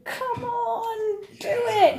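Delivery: high, pleading cheer; rooting encouragement. Retold or imitated. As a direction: urge them on from the crowd. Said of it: "rooting for going".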